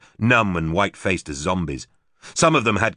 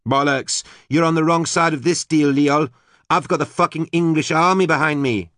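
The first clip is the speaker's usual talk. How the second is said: high, loud defiant retort; defiant shout; 10 semitones higher, 4 dB louder than usual. A narrator's reading. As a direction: throw it back at him. Susie defiant to Leol.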